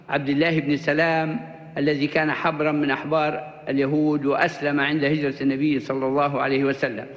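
ordinary delivery

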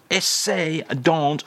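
ordinary delivery

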